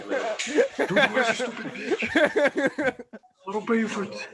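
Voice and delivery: high-pitched pretend tirade through laughter; mock angry yelling. Angry Red Cross leader berating underlings, hammed-up and laughing. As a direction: play the furious boss, laughing as you go. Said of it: "command them and yell at them".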